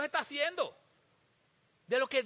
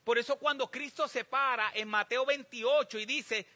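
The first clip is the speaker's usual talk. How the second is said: ordinary delivery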